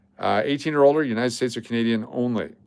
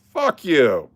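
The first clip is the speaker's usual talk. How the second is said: mock angry retort; 4 semitones higher, 4 dB louder than usual. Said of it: "And I'm like".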